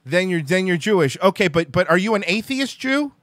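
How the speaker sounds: usual speaking voice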